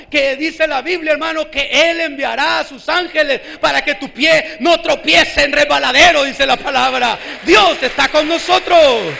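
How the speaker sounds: loud, slow, proclaiming sermon voice; full-force preaching. Acted. Pastor proclaiming to the congregation that God is present, loud preaching. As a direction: thunder it out to the congregation.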